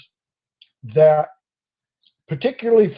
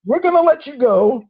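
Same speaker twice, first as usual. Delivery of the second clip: higher, chuckling officer voice; the officer letting him go, amused and higher; 7 semitones higher than usual. Retold or imitated. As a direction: play the cop half laughing.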